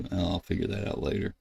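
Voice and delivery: ordinary delivery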